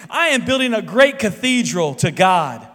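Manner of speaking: loud, proud declaration; proud exclamation. Acted. Third bricklayer proudly answering the stranger, loud exclamation. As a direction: announce it with full conviction. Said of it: "He exclaimed".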